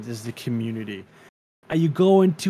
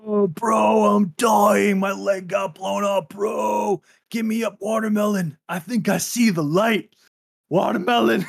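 high frantic dying soldier voice; panicking gamer; 8 semitones higher, 3 dB louder than usual. Retold or imitated. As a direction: plead like a wounded soldier in panic. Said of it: "all I hear is".

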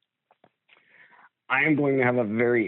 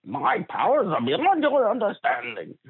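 much higher cartoon villain voice; Skeletor; 11 semitones higher than usual. Co-host mimicking the cartoon villain after the lookalike jibe, Skeletor impression. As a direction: boast in a high-pitched supervillain impression. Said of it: "that's Skeletor".

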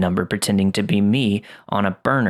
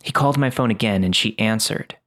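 usual speaking voice

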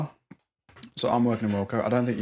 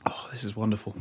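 whisper; 9 dB quieter than usual. Real, not acted. Delighted aside whispered.

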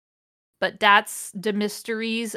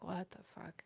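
muttered disbelief; 4 semitones lower, 23 dB quieter than usual. Retold or imitated. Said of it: "but I'm just like".

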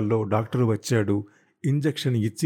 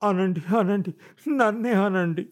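bitter self-pity; 7 semitones higher than usual. Acted. Wife plays victim.

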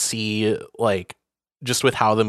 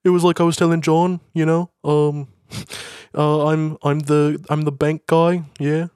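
high put-on Liverpool accent; 5 semitones higher than usual. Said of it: "do the Liverpool accent".

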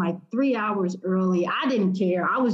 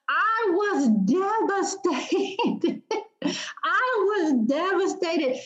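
laughing; 9 semitones higher, much slower than usual. Real, not acted. Laughing retelling of sold-out tickets.

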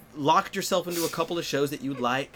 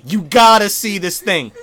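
slow, very loud, higher mock insistence; pushy urging; 5 semitones higher, 13 dB louder than usual. Comedian imitating everyone pushing a show on you, loud urging. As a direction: hammer it out like an overexcited fan.

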